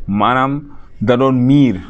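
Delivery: ordinary delivery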